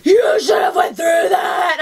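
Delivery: slow, high, loud scolding shout; mock screaming. DP scolding his driver at the yellow light, drawn-out mock shout. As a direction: imitate being screamed at. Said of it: "You didn't get screamed at".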